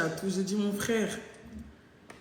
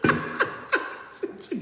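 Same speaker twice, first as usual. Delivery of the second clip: breathless giggle through the words; laughing; 4 dB quieter than usual. Real, not acted. Laughing as she retells her reply to her boyfriend. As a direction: giggle while getting the words out.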